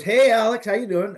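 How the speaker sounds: loud, high-pitched, imitated hello; retold enthusiastic greeting; hearty phone greeting. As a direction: greet an old pal on the phone, hammed up. Imitating man greeting a friend.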